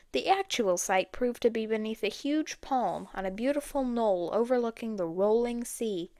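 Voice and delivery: ordinary delivery, nothing marked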